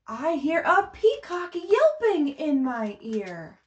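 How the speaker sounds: slow, sing-song picture book reading; reading to children. Acted. Teacher reading Polar Bear aloud to a baby class, sing-song. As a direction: read it to the babies in a lilting rhythm.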